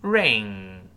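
slow model "ring" ending in a long ng; velar ng ending. Shown as a demonstration. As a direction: hold "ring" on a back-of-tongue nasal. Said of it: "把喉咙堵死了".

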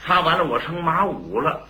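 normal talking voice

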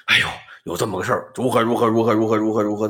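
hurried panicky gabble. Acted. Scholar asks relatives in panic.

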